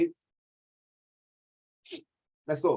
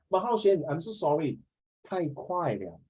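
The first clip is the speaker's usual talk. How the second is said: ordinary talk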